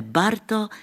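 put-on high, bright call of a child's name; cry out. A narrator's reading. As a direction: call out to the shepherd from afar. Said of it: "vykřikne". (dívčí radostné zvolání)

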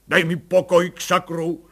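loud irritated shout at his wife; angry outburst. Acted. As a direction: snap her away, fed up. Colonel snaps at his wife.

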